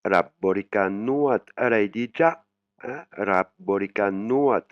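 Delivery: slow, careful Thai Rabborikan Nuat; Thai phrase demo. Shown as a demonstration. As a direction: spell out Rabborikan Nuat slowly, Thai style. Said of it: "Quel massage vous voulez". (Rabborikan Nuat articulé lentement, à la thaïe)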